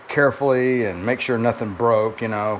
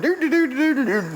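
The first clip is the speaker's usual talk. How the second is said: high-pitched, singsong "doo-doo-doo"; imitated dangling noise; 12 semitones higher than usual. Mechanic mimicking the engine dangling from one bolt, high silly doo-doo noise. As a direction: rattle off a high, silly string of doos.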